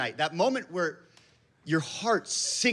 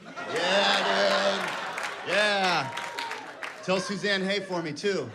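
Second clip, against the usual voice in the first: stoned friend; 4 semitones higher, much slower than usual. Retold or imitated. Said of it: "Cameron's in the corner just like".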